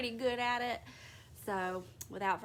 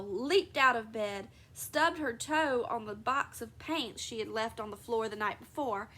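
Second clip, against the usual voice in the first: usual speaking voice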